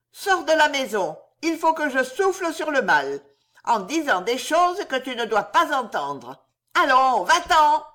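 high, loud crone voice ending in a shout; old sorceress chasing her off; shrieking witch, go away; witch sending her out. Acted. Witch sends Madeleine out.

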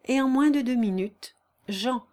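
ordinary delivery, nothing marked

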